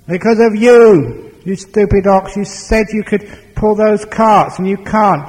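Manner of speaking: high, loud, exasperated outburst; angry blame. Acted. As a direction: hurl the blame back at them. Master blames ox in quarrel.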